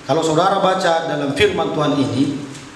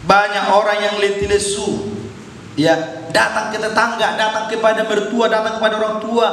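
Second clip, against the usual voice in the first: fervent preaching; 7 semitones higher than usual. Acted. Preacher urging the congregation to bring their broken hearts to God, fervent. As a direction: rouse them to come to the Lord.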